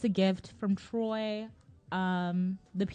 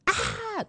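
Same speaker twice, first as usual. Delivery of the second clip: high-pitched mock scream of pain; mock yell. Storyteller imitating the blinded Cyclops, high mock yell. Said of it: "the Cyclops yells".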